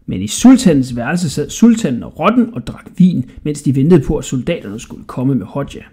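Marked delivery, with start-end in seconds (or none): none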